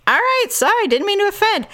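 high sing-song sorry; sarcastic high apology; mock apology. Mock apology to snooty host.

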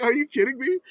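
high, loud outraged disbelief; shocked at the price tag. Retold or imitated.

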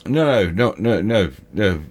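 ordinary delivery

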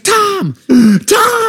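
very high-pitched panicked mom yell; shrieked name, high and urgent; retold scream. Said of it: "she's instantly screaming".

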